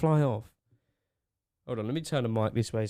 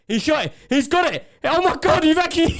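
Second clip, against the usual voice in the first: excited; 14 semitones higher, 8 dB louder than usual. Real, not acted. Pet pigeon finally shot, cheering.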